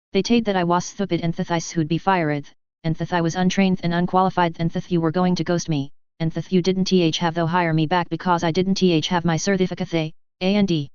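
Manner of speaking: thick lisp, "taid" for said, th for s; tongue-damaged lisp, stumbling on "didn't th have". A narrator's reading. Jaraz repeating insults to Gavin, lisping "taid". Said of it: "Jaraz lisped".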